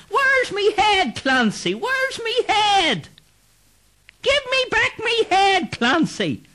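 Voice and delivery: very high, slow ghostly shouting; wailing ghost. Acted. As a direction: moan and shout after him like a ghost. Ghost chasing Clancy.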